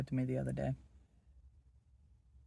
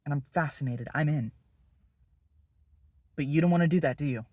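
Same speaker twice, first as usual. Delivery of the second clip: louder, jabbing each phrase; loud taunting dare; 7 dB louder than usual. Acted. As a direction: push him into a corner. Daring Will to open up.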